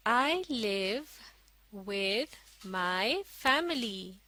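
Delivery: very slow, word by word; slow model reading. Shown as a demonstration. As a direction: read it out slowly for learners to follow. Model reading for learners to follow. (धीरे-धीरे, एक-एक शब्द पढ़कर)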